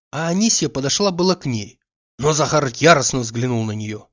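ordinary delivery

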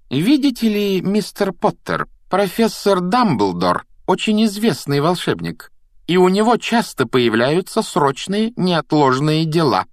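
high, slow, deliberate schoolmistress; lofty, stretched-out lecturing; condescending explanation. A narrator's reading. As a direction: spell it out to a pupil. McGonagall lectures Harry condescendingly.

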